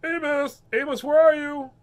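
high, loud searching call; high-pitched hollered name-calling. Acted. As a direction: shout for a lost dog across a ferry. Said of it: "is calling me".

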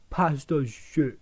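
slow, stupid Bristol voice; mock Bristol accent. Quoting the pasta hater in a stupid Bristol voice. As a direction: drag it out in a daft Bristol accent. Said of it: "in his fucking stupid Bristol voice".

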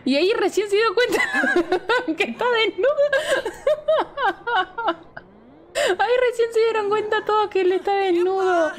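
laughing high. Real, not acted. Laughing at naked sim.

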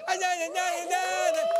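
high drawn-out moans; moaning. Acted. Acted moaning.

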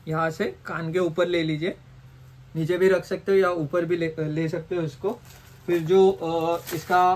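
muffled behind cloth mask; speaking through fabric; through a face covering. Real, not acted.

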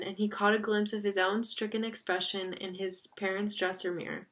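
usual speaking voice

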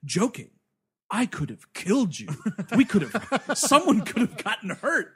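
indignant imitation of the hero; mock pissed off. Retelling hero scolding a joking opponent, hammed-up indignation. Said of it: "he gets all pissed off".

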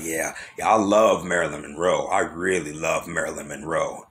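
low, monotone tattoo artist imitation; imitated low, lingering adoration; tattoo artist's creepy devotion. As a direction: drop into a deep, level voice to mimic him. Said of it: "this is the way he said it".

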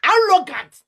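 loud, high cry of contempt; scornful shout. Real, not acted. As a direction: spit the word out at them. Angry outburst at the preachers.

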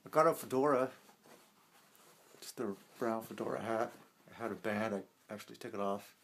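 speaking inside rubber mask; through a face mask. Real, not acted. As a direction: voice muffled by mask. Seen 1: Speaker wears full-head silicone mask throughout; R frames unmasked.

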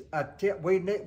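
normal talking voice

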